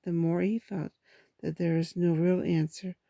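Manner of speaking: usual speaking voice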